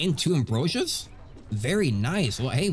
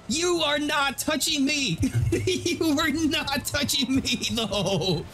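mock whiny protest; 12 semitones higher, much slower than usual. Real, not acted. Mocking the boss after loss.

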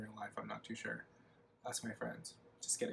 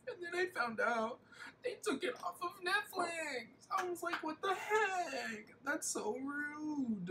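mock outrage; 14 semitones higher, 5 dB quieter than usual. Complaining to viewers about Netflix, high mock whine. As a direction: squeak it like a scandalized child.

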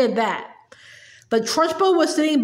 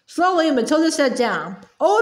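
ordinary delivery

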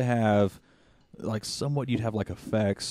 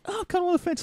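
mock hesitant; much faster than usual. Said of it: "and you're like".

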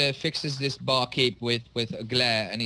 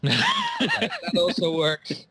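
high, slow words through laughter; laughing; 4 dB louder, much slower than usual. Real, not acted. Player amused by the father slapping the kid, laughing. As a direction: say it while really laughing.

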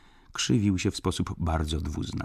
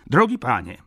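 baron calling out loudly; loud, high, emphatic address; 5 semitones higher, 8 dB louder than usual. A narrator's reading. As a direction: cut in with a raised, higher voice. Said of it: "zawołał nie mniej głośno baron".

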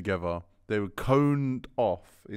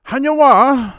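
high, loud, hammed-up cry of fake Chinese; mock Chinese greeting; 17 dB louder, slower than usual. Footballer greeting Chinese fans, in loud, high mock Chinese. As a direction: blurt a bogus hello, pitched way up and loud. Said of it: "Adebayor goes".

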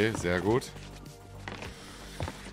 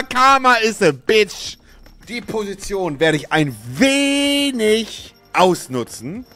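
gloating; 8 semitones higher, slower than usual. Acted. Gloating over opponent.

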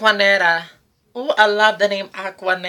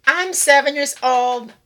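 put-on much higher kid voice; little boy voice; 10 semitones higher, 4 dB louder than usual. Host imitating a little boy answering his age, high and childlike. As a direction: talk like a seven-year-old boy. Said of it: "asking a little boy".

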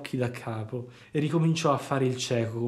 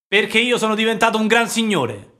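put-on much higher, louder proud boy; boastful puppet; 9 semitones higher, 8 dB louder than usual. A narrator's reading. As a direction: show off his new wealth. Pinocchio boasts. (Pinocchio che si vanta, voce molto più alta e forte)

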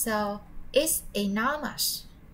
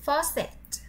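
model word; 5 semitones higher, slower than usual. Shown as a demonstration.

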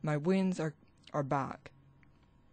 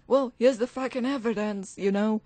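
louder and higher, a real burst of anger; angry outburst; 6 semitones higher, 6 dB louder than usual. Real, not acted. Vlogger venting at the man who called him a demon, angry outburst. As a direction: snap it out, fed up and heated. Vlogger getting worked up, ranting.

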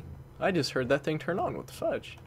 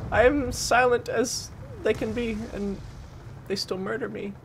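frustrated complaint; 9 semitones higher than usual. Real, not acted. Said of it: "That's rhymey".

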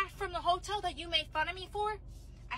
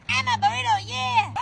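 higher odd little creature croak; creepy creature voice; 7 semitones higher, 9 dB louder than usual. Acted. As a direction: speak like a weird tiny critter. Strange creature speaks.

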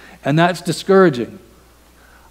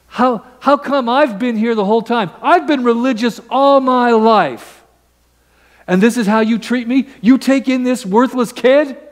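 higher, aggrieved whine at his father; older brother's indignant complaint, pitched up; resentful complaint; 9 semitones higher than usual. Acted. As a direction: let the bitterness out.

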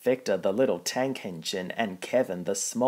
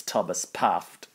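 ordinary delivery, nothing marked

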